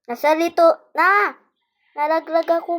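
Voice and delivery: loud call to mom. Real, not acted. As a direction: shout across the room. Calling mom while playing.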